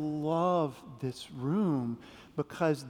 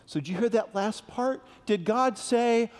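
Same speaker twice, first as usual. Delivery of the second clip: ordinary talk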